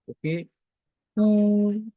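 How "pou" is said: "pou" high, long and level; sustained, unbending high "pou"; first tone. Shown as a demonstration. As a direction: hold "pou" on one high note. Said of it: "le ton constant, le premier ton".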